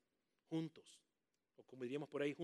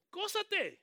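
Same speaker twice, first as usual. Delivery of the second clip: high, loud cry of gózate; shouted exhortation; 7 dB louder than usual. Real, not acted. Preacher urging the church to rejoice, shouting. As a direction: fling gózate up to the rafters.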